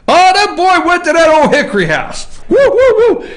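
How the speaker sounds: high, loud gleeful hoot. Real, not acted. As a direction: crow over the discovery, then hoot. Host gloats over the scandal.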